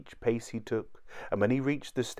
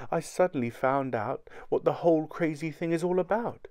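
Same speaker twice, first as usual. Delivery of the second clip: lightly voiced wife, higher pitch; higher voice of a wife confiding; wife making a revelation; 6 semitones higher than usual. A narrator's reading. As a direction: reveal it in a raised voice.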